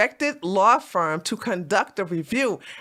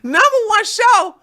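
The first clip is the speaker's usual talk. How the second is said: mock disbelief; 11 semitones higher, 8 dB louder than usual. Mocking the claim.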